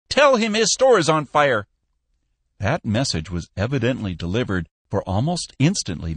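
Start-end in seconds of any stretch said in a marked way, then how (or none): 0.1-1.7 high, loud alarm call; shrieking. A narrator's reading. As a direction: shriek the urgent message down the phone. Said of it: "shrieked Peck".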